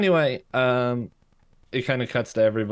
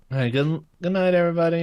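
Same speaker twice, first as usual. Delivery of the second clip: cheery goodnight; 9 semitones higher, 5 dB louder, faster than usual. Retold or imitated. Mabel saying goodnight to puppets.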